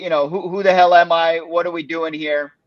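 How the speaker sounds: normal talking voice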